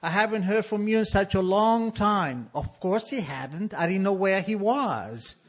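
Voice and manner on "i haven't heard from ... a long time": a lightly voiced father, higher; hint of a wheedling father; father's letter voice. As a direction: read the letter as dad, sweetly fake. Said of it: "It said".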